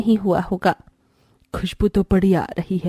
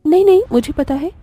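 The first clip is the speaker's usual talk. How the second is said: higher, louder hasty denial; flustered denial; 6 semitones higher, 5 dB louder, faster than usual. Acted. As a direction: blurt it out to cover up. Bride hastily covers ignorance.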